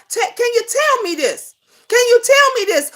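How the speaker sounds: usual speaking voice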